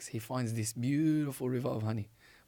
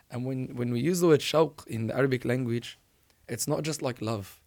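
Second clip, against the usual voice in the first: ordinary delivery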